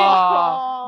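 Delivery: loud high shocked cry. Real, not acted. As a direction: cry out in dismay. Shocked at pistachio news.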